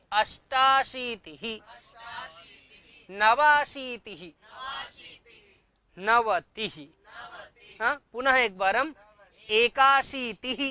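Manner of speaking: normal talking voice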